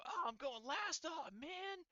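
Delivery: high mock complaint about going last; imitating Johnny. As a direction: put on a high, sulky "poor me" voice. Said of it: "You hear Johnny go".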